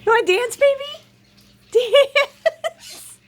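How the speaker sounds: high, loud, laughing cheer; excited cheer. Real, not acted. Owner urging her parrot to dance, excited and high. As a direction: egg the bird on, thrilled.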